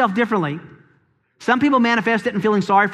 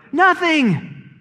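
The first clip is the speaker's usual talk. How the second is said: emphatic high answer; 8 semitones higher than usual. Acted. Answer to rhetorical question.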